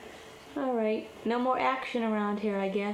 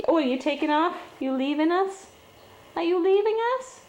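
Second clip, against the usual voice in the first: high, louder pet talk; talking to a kitten; 8 semitones higher, 6 dB louder than usual. Real, not acted. Asking the kitten if it's leaving, in high pet talk. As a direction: coo loudly at the kitten.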